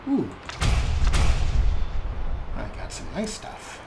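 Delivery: normal talking voice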